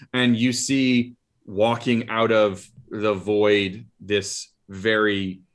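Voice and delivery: normal talking voice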